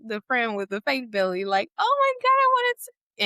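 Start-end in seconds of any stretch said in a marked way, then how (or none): 1.8-3.2 high, loud squeal of delight, acted out; mock excited gushing. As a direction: imitate an overexcited friend squealing. Friend excitedly reaching for belly.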